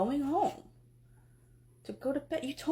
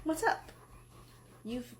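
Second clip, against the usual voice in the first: usual speaking voice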